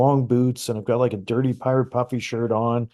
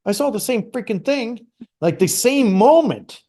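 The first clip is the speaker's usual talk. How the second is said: amazed excitement; 9 semitones higher, 4 dB louder than usual. Retold or imitated. To his ex-wife about their shared vision, excited and amazed. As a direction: blurt it out, thrilled and astonished. Telling ex-wife he saw it too.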